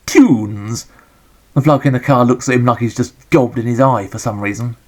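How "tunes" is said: throat-clearing cough-sweet ad voice; cleared throat. Retold or imitated. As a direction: say it like an old telly advert, throat just cleared. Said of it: "Clears up his throat and he goes".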